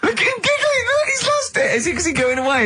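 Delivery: very high squeal breaking with real laughter; hysterical delight. Real, not acted. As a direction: shriek helplessly through the giggles. Cracking up at co-host's giggling.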